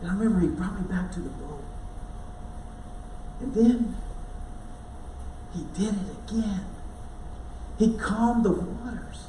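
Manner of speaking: usual speaking voice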